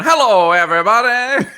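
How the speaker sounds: high, booming showman greeting, half laughing; Jawa carnival barker. Retold or imitated. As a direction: greet the crowd like a fairground barker. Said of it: "you've got one that's just going".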